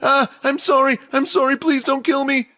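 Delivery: high-pitched panicked pleading; acted scream of a terrified student; screaming in fear. A narrator's reading. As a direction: beg for your life in a high, frightened cry. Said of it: "caused him to start screaming".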